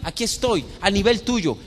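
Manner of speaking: high, loud pulpit delivery; impassioned preaching. Acted. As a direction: drive each phrase home to the whole congregation. Preacher at full force.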